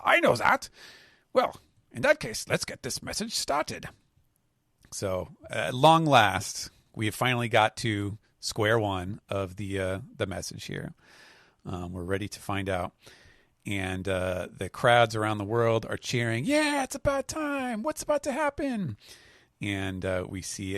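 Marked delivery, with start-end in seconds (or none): 0.0-3.9 much higher cartoonish scientist voice; high put-on voice. Scientist answering his assistant, high cartoon voice. 16.4-19.0 very high squeaky crowd voice; cheering crowd voice. Acted. World crowds eager for the broadcast, squeaky high voice.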